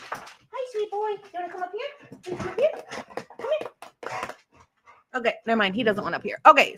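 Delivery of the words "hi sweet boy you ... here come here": high, soft cooing to dog. Real, not acted. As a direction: coo sweetly at a pet. Cooing to her pet dog.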